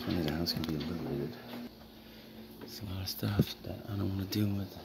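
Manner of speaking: ordinary talk, nothing marked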